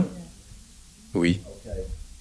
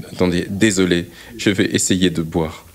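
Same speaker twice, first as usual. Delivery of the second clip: talking through hiccups; hiccuping; 4 semitones lower than usual. Real, not acted. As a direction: caught by hiccups mid-sentence. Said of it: "j'ai le hoquet".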